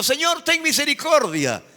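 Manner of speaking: high whining plea; a believer begging mercy, mockingly high; mock pleading. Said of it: "No se te ocurra estar diciendo".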